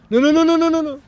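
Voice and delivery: high, loud rapid no's; alarmed no's. Real, not acted. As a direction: panic as the screen breaks. Stream screen goes wrong.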